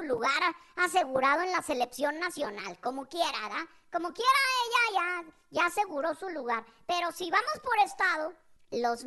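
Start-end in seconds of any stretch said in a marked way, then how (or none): none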